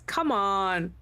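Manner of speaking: normal talking voice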